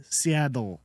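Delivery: "Seattle" said slowly as "Seaddle". Shown as a demonstration. Showing his accent, "Seattle" with a D. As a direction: turn the T in "Seattle" into a D. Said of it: "like, with a D".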